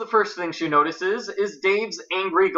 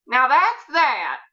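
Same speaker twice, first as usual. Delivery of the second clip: higher, slow, firm declaration; aunt's announcement; 9 semitones higher, slower than usual. A narrator's reading. Aunt announces to the guests, firmly wrapping up. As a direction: close the matter for the whole table. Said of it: "Aunt Bet announces".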